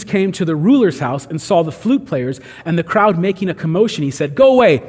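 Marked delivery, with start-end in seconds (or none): 4.3-4.8 louder, higher call to clear the room; firm command. Acted. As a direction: order the crowd away firmly. Acted command of Jesus to crowd.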